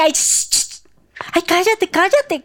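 high, exasperated crowd member; annoyed shushing. Acted. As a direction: shush him, fussy and high-pitched. Crowd hushes the blind man. (callándolo con fastidio, voz aguda)

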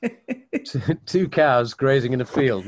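normal talking voice

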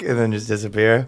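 normal talking voice

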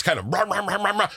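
normal talking voice

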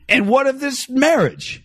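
slow, high, grand archaic voice; mock old-fashioned formal. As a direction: intone it like a medieval noble. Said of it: "did you say it like that?".

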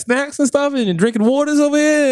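high, nosy New York patter; New York construction worker. Pretend. Worker eyeing the recording gear, nosy New Yorker. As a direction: pile up questions like a worker butting in. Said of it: "turned into a New York construction worker".

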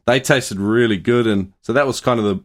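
normal talking voice

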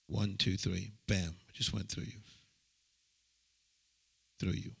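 low, soft intimate ministering voice; healing ministry prayer. Acted. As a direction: pray over her quietly and firmly. Healing prayer over a woman.

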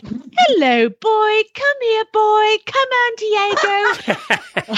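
very high squeaky cooing voice; squeaky falsetto mockery. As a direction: coo at the horse in falsetto. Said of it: "That's not what I sound like".

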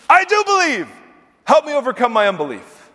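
high, loud, pleading father crying out to Jesus; desperate father's cry. Acted. Father begs Jesus to heal his son, a high pleading cry. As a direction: throw the words out like a desperate father. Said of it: "the boy's father exclaimed".

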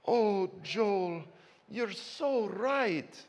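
put-on high, soft, slow old-lady voice; sweet little old woman. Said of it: "this little Scottish woman came up to me".

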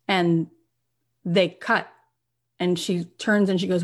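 usual speaking voice

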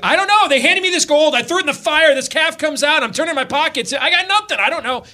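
high, loud panicked excuses; Aaron pleading with Moses, panicky and high; flustered Aaron. Retold or imitated. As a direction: babble like a man caught out. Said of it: "Aaron's like".

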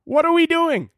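very high, strained outraged shout; shrill-high disbelieving yell; voice shooting up. Real, not acted. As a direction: let the voice crack upward in outrage. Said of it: "My voice just had to go up eight octaves".

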